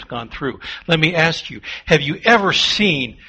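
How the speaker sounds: loud, driving sermon delivery; preaching at full force. Acted. Pastor preaching loudly to the congregation, full force. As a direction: hammer the point home to the congregation.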